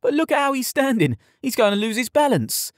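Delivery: very high, cheeky taunt; gleeful jeering. A narrator's reading. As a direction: point out his flaw with mocking glee. Stable boy keeps jeering at stance.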